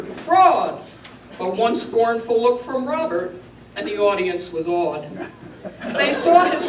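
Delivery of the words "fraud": echoing cry. A narrator's reading. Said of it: "and echoed answer".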